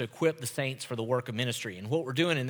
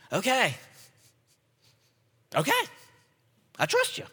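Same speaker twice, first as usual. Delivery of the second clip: amazed surrender; 9 semitones higher than usual. Retold or imitated. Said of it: "we're able to say".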